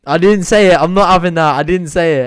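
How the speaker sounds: loud, high, protesting denial; indignant shout. Real, not acted. Streamer shouting at chat that he never read the joke name out. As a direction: yell it to the chat like you've been framed.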